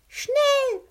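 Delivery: very high, loud yell; scream. Acted. Captain K yelling at his friends to hurry, a very high loud scream. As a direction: scream it to hurry them through the door. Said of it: "schrie der Captain".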